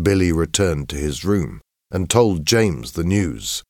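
ordinary delivery

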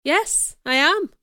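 surprised. A narrator's reading. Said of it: "I replied in surprise".

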